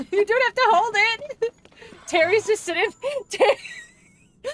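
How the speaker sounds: high voice breaking up with laughter; laughing hard. Real, not acted. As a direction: barely get it out through laughs. Cracking up retelling a funny moment.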